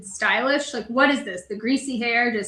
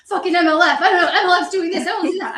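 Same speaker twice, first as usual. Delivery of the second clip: high, loud mock rant; lightly hammed-up imitation of his fussing; mocking a worked-up man; 7 semitones higher, 6 dB louder, faster than usual. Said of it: "He's like".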